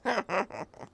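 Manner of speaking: soft coquettish giggle; flirty giggle. Acted.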